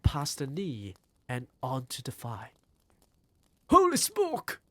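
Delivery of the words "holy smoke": high, loud shocked yell. A narrator's reading. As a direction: shriek it in disbelief. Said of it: "the man was yelling".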